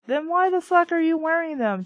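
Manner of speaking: loud, exasperated high rant; exasperated outburst. Real, not acted. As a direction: yell at the book in disbelief. Reader rants at the book.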